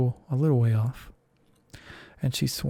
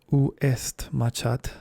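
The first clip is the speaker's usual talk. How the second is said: slow, careful French phrase; deliberate French textbook line, Où est ma chatte; schoolbook French; slower than usual. Acted. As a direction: say it like a lesson sentence, slowly. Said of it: "the first sentence in her French lesson book".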